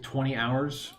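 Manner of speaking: ordinary talk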